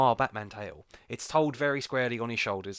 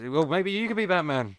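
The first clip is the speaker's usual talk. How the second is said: higher, louder teasing tone; 6 semitones higher, 5 dB louder than usual. Real, not acted. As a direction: tease him playfully.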